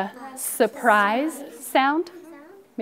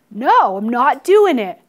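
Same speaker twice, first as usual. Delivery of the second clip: loud, slow, emphatic refusal; acted stubborn outburst; defiant refusal; 11 dB louder than usual. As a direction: dig in and belt out each word.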